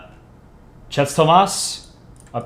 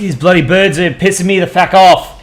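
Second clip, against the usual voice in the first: put-on loud, higher-pitched irate Australian accent; Aussie accent; 4 semitones higher, 5 dB louder than usual. Streamer reading an Australia versus emus comic, angry Aussie accent. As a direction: go broad Aussie, cursing loudly at the birds. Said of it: "That was a pretty good Aussie accent".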